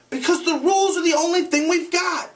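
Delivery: high urgent pleading. Acted. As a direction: plead for order. Ralph argues desperately.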